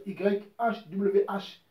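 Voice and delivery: «Y H W H» épelé lentement, lettre par lettre; détacher chaque lettre de «Y H W H» avec une pause; spelled out letter by letter. Shown as a demonstration. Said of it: "ça reste les quatre lettres". (épeler «Y H W H» très lentement, lettre après lettre)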